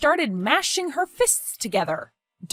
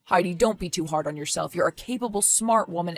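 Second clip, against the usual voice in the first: ordinary talk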